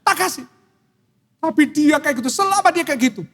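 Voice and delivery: high-pitched wife pleading her case; complaining wife. Acted. Wife telling the pastor about her husband, high-pitched complaint.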